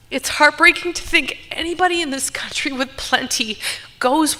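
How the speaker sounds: holding back tears while praying for the country; emotional. Real, not acted. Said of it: "I didn't expect to get emotional".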